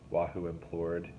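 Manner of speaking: plain reading voice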